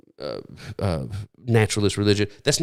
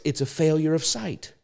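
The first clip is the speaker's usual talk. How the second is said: higher pitch, falling on the last word; emphatic key point; 6 semitones higher than usual. Real, not acted. Preacher correcting the excuse to his listeners, high and emphatic. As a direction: land "It's a failure of sight" high and firm.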